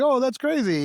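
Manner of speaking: high, bright-eyed fake amazement; jokey over-the-top wow; mock surprise. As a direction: squeal it like you can't believe it. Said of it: "And I'm like".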